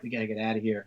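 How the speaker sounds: low, soft escape plea; confiding aside. Retold or imitated. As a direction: drop it to a discreet undertone. Couple confiding they want out.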